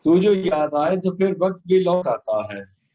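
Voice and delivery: solemn, measured poem delivery; stately nazm recitation. Acted.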